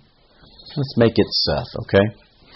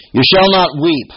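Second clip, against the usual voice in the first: weep punched high and loud; stress on weep; 8 semitones higher, 7 dB louder than usual. Shown as a demonstration. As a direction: hit the word weep hard. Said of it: "I emphasize weep".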